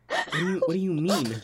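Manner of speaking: indignant retold "excuse me?"; indignant disbelief. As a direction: act out being taken aback. Teller acts out indignant confusion.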